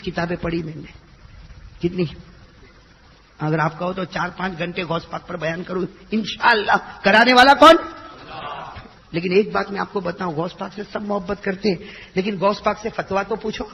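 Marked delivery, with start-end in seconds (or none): none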